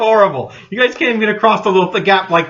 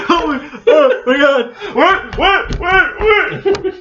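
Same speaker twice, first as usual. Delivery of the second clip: rising panicked squeals; high panicked voice; 10 semitones higher, much slower than usual. Retold or imitated. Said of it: "And they're like".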